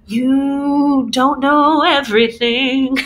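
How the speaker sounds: slow, otherworldly cosmic voice; voice of the universe. Retold or imitated. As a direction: speak slowly, like the cosmos itself. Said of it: "It's been the universe going".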